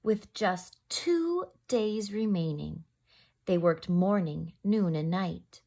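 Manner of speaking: gentle rhyming read-aloud; story narration. A narrator's reading. Teacher reading to children in storytime voice. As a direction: read it like a bedtime picture book. Reads picture book aloud.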